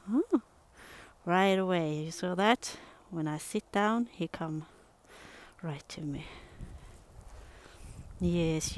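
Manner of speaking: ordinary talk, nothing marked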